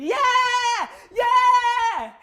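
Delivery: high, loud, drawn-out yell; screaming cheer. Acted. Shirtless drunk fan cheering her on, high scream. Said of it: "starts screaming".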